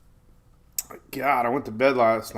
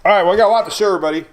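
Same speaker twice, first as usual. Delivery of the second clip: normal talking voice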